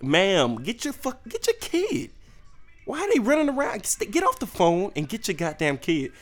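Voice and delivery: high, exasperated yell at the mother; mock yelling at mom. Comedian yelling at a shopper to control her kids, high and exasperated. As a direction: shout up at her to get her kid.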